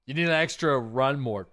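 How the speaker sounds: usual speaking voice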